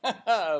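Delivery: short loud real laugh; loud burst of laughter. Real, not acted. Vlogger cracks up at the GM's answer, loud laugh. Laughs at his own retelling.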